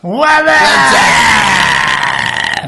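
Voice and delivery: high, loud, long drawn-out What's up; Whassup yell. Pretend. Answering a friend's cue with the long yelled Whassup. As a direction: bellow a stretched What's up. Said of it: "unless we say what?".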